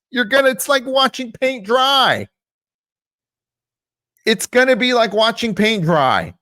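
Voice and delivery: loud, high-pitched venting; exasperated rant. Real, not acted. YouTuber ranting to viewers about bad advice, loud exasperation. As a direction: raise the voice in real exasperation.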